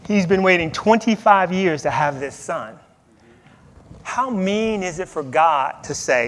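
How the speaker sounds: ordinary talk, nothing marked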